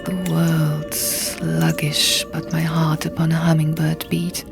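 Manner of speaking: ordinary delivery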